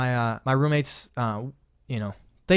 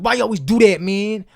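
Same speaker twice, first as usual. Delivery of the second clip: higher and much louder complaint; angry dishwasher; 8 semitones higher, 10 dB louder than usual. Retold or imitated. Dishwasher yelling about loud plates, angry. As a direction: yell at him for the noise. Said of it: "the dishwasher yelled at me".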